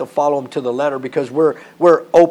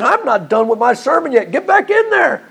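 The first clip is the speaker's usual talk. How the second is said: high, insistent comic command; mock order; 9 semitones higher, 4 dB louder than usual. Preacher as Paul orders revived Eutychus back to the sermon, mock-stern. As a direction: pitch soars on a hammed-up order to return.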